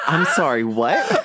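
laughing shout of disbelief. Real, not acted. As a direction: blurt it out mid-laugh. Shocked by the character name.

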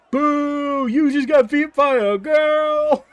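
loud, high jeering boo; booing crowd. Acted. Crowd booing the beaten fighter, loud jeer. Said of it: "I'm like".

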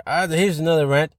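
ordinary talk, nothing marked

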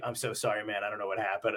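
apologetic. Retold or imitated. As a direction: softly apologize to a friend. Friend's apology, quieter, lower.

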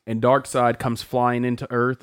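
usual speaking voice

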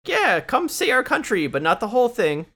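high, loud sing-song sales pitch; hammed-up brochure voice, selling the country; mock tourism ad.

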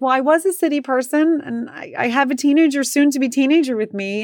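high-pitched, amused self-defense; amused protest. Retold or imitated. Driver answering the man about city people, amused protest. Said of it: "And I kinda laughed".

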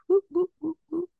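high playful whoop; siren whoop. Pretend.

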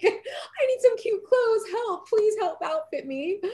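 high, slow, pleading and laughing; mock pleading shopper. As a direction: beg for cute clothes in a high, drawn-out laughing plea. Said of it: "And I'm like".

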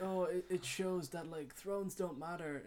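mock pretentious analysis. Mocking a pretentious show analysis.

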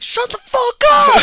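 high, loud, drawn-out mock bellow; angry dad shout. Retelling dad losing it during Heads Up, angry shout. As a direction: explode slowly on every word, imitating dad. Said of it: "so angry".

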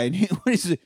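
ordinary talk

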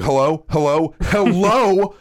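imitated impatient phone greeting, escalating; impatient caller. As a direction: mimic a caller pestering for an answer, building. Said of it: "most impatient people on the fucking planet".

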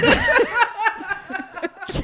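high-pitched, loud burst of laughing; laughter. Real, not acted. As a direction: crack up laughing, long and high. Cracking up at the setup.

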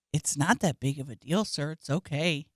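soft, syrupy calm to an angry man; mock-sweet reassurance; patronizing calm. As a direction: soothe him to rile him. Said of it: "I stayed so calm".

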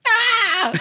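high loud bleating cry. Retold or imitated. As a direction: yelp it up high. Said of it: "He's like".

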